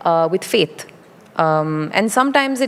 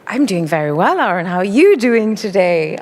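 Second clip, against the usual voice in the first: British accent. Shown as a demonstration. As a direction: answer like a proper Brit. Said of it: "see you do a little bit of British accent?".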